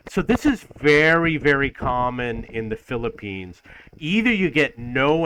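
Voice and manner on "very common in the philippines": usual speaking voice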